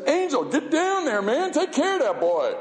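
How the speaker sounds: high, booming voice of God ordering an angel; God's command. Retold or imitated. God orders an angel to protect his boy, in a high commanding voice.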